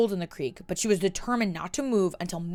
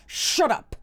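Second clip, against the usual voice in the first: snarled; 8 semitones higher, 3 dB louder than usual. Acted. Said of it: "she snarled".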